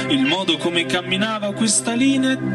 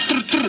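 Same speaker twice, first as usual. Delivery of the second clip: quick rattling "trr, trr" of an air wrench; imitated wrench noise; 3 semitones higher than usual. Worker mimes the automatic air wrench at work, imitated whirr. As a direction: mimic the pneumatic wrench in two short bursts.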